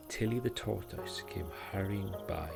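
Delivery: plain reading voice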